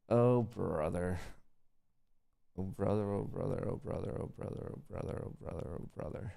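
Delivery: weary groan. Real, not acted. Watching the trade stall, fading exasperation. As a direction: mutter it over and over, trailing off.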